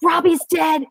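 a scheming mother pressing her husband; mock insistent. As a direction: push him hard to play along. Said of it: "she's like".

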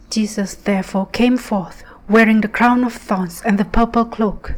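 usual speaking voice

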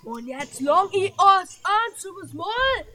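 Oma-Stimme. Acted. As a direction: threaten like an enraged old lady. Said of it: "[name] ist die Oma". (wütende Oma, laut und gedehnt)

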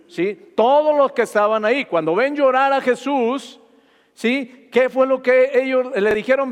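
loud, high pulpit preaching, climbing; full-force fervent preaching. Acted. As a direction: drive the verse home to the congregation.

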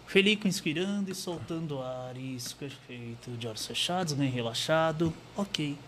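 soft, calm hypnosis induction; hypnotist voice. Acted. Hypnotist guiding Felipe to relax, soft and calm. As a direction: lull Felipe into trance.

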